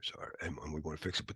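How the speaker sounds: whispered apology. Real, not acted. Lecturer apologizing to the class in a whisper. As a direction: breathe the sorry out, barely voiced.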